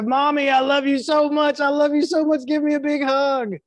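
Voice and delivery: put-on high, eager little child gushing; child's voice. Child runs up to mother.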